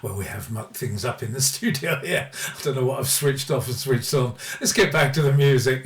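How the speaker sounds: ordinary delivery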